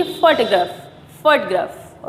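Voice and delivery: "फोटोग्राफ" as FOT-graf, middle vowel nearly dropped; "फोटोग्राफ" with an open, English first vowel; corrected pronunciation. Shown as a demonstration. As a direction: model "फोटोग्राफ" as FAA-tuh-graf, falling. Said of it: "क्या बोलेंगे?".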